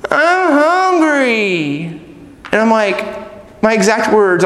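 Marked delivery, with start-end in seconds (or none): 0.1-1.8 put-on long drawn-out child's whine; whining. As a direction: whine it, stretching every word out. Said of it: "then they'll start whining".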